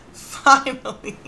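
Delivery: a slow finally with a laugh through it; slow, laughing relief on finally. Real, not acted. The word repeated with a laugh.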